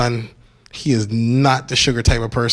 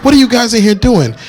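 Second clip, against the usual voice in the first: put-on loud, higher kid shout; kid yelling; 8 semitones higher, 7 dB louder than usual. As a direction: yell it like an angry kid. Kid bursts in on couple.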